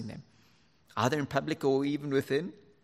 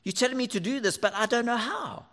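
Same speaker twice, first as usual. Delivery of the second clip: put-on high whiny child voice; a child complaining to dad; protesting kid; 11 semitones higher, 3 dB louder than usual. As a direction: plead like a confused kid.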